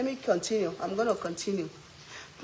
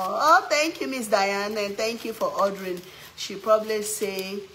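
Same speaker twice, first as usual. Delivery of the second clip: usual speaking voice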